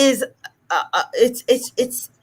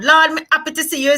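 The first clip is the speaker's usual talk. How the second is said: quick, higher patois, happy without its H; casual Jamaican patois; 6 semitones higher, faster than usual. Shown as a demonstration. Speaking to a friend down the road, quick Jamaican patois. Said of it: "speaking to your friend down the road".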